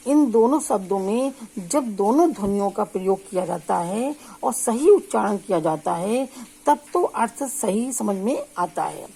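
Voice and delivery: ordinary talk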